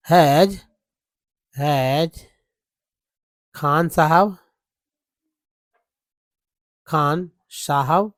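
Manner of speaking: slow, word by word, with pauses; dictating while writing. Shown as a demonstration. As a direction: say each word as you write it. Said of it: "ले ले रहा हूँ मैं". (लिखते हुए धीरे-धीरे बोलना)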